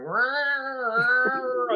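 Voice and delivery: high mock cat growl. Retelling the stranger's cat growling at her, mock growl.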